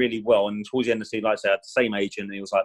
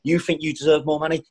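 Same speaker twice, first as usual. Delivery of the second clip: higher, mocking angry question; imitating a scornful manager; retold angry rant; 6 semitones higher than usual. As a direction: jeer at him like the furious gaffer. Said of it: "And he's going".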